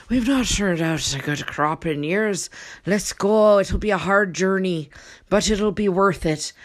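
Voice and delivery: tipsy, slurred Irish brogue; rally them like a drunken Irishman; drunk-sounding Irish accent. Acted. Ancestor urging the family to go, drunk Irish accent. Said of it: "if I sound drunk, I sound Irish".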